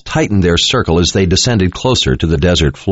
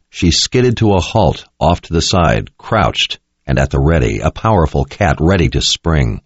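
ordinary delivery

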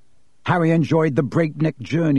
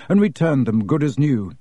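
ordinary delivery

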